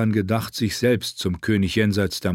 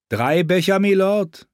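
much higher servant voice, rising; servant's question; 9 semitones higher, 4 dB louder than usual. A narrator's reading. Servant checking an order with his lord, high questioning voice. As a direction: ask it high and eagerly polite.

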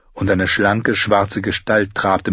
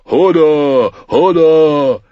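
loud, raised simple-giant yell; Hodor shouting; 7 semitones higher, 7 dB louder, much slower than usual. Acted. Hodor bellows his name at the wolves, louder. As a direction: bellow while stamping after the wolves.

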